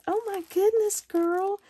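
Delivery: thrilled squeal of delight; delighted gasp. Real, not acted. Crafter opening her friend's package, high thrilled squeal.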